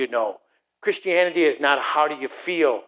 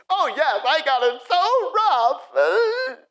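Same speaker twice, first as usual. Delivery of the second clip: mock self-pity. Believer answering God about having it rough, exaggerated whining. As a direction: wail pitifully, hammed up.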